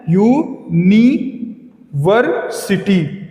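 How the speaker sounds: University split slowly as यू, नि, वर, city; syllable by syllable. Shown as a demonstration. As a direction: break university into rising beats with pauses, city run together. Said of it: "इसमें चार syllable हैं।".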